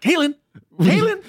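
high, pointed name-calling; mock calling out. Host pretends to confront a candidate, high mock calling. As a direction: call the name in a high comic voice. Imagined person calling Caitlyn out.

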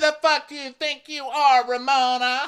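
high, loud mock outrage; mock fury. Kenya confronting Ramona in a high, loud mock rage. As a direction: blast it like a reality-show blowup. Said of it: "She's like".

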